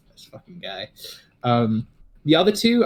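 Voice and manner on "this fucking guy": muttered under the breath, much softer; disgusted mutter. Acted. Kylo shakes his head at Avidar, muttering quietly. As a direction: grumble it quietly to yourself. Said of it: "shakes his head like".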